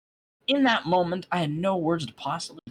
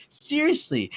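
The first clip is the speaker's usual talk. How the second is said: ordinary delivery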